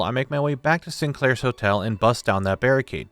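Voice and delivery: ordinary talk, nothing marked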